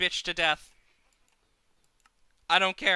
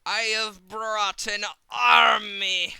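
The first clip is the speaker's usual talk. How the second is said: triumphant shout; 5 semitones higher, 8 dB louder, slower than usual. Acted. Triumphant announcement.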